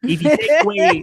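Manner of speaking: Cecil impression. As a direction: put on a Chicago accent. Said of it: "I can't do a good Cecil".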